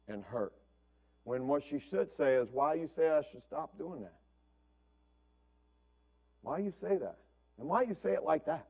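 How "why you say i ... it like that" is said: quiet, calm asking back instead of sulking; soft calm questioning. Retold or imitated. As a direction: ask gently why it was said like that. Daughter calmly asking why.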